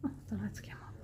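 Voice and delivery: hushed surprised whisper. Real, not acted. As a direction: whisper it softly. Whispering inside a quiet church.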